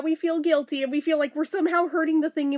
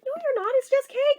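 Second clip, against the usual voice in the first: mom reassuring; 11 semitones higher than usual. Retold or imitated. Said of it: "She's like".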